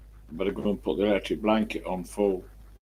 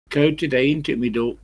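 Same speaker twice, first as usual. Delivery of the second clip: raised, lilting East Midlands greeting; East Midlands accent; 6 semitones higher, 4 dB louder than usual. Shown as a demonstration. Nottingham man showing off his local dialect, East Midlands accent. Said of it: "we've got the East Midlands accent".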